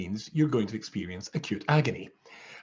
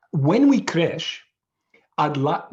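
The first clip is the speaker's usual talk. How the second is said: slow, high, loud South African stewardess; South African accent; 5 semitones higher, 7 dB louder, much slower than usual. Retold or imitated. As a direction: instruct slowly in a put-on South African accent. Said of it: "in her South African accent".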